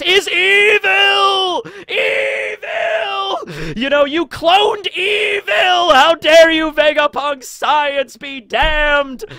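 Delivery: high, drawn-out hammed-up cry of doom; melodramatic shouting. Fan denouncing Vegapunk for cloning evil, hammed-up high shout. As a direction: wail it out in mock outrage, stretching the big words. Mock villainous outburst at the scientist.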